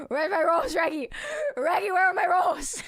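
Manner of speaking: loud demanding shout for rolls; quoted shout. Retold or imitated. Quoting the video, demanding her rolls with a shout. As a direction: yell it like the video.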